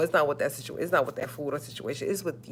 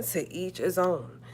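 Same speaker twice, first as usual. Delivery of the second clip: slow and deliberate; 6 dB quieter, slower than usual. Real, not acted. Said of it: "repeated slowly".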